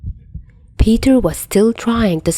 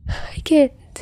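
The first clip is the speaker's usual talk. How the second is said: tearful whisper; 8 semitones higher than usual. Acted. Peter crying against the door.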